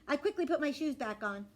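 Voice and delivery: ordinary delivery, nothing marked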